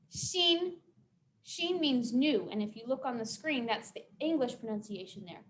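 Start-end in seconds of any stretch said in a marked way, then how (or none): 0.1-0.8 "Xin" held high and even; level high tone. Shown as a demonstration. Teacher modelling the word for new, high level tone. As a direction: sustain "Xin" on one high note. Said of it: "go like this".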